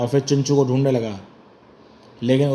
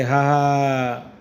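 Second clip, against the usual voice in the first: taunting laugh; much slower than usual. Acted. Mouse taunts the fat one.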